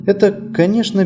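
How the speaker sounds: ordinary delivery, nothing marked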